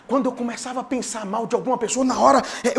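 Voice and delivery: normal talking voice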